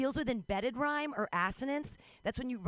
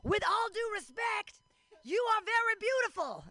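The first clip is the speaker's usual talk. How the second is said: high shouted compliment from a car window; imitated yell; 10 semitones higher than usual. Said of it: "yelled out his window".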